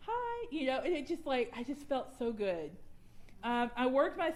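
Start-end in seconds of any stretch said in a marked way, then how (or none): 0.1-0.5 bright high greeting; cheery hi. Retold or imitated. Friend Karen greeting the bride, very high and cheerful. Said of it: "she was just like".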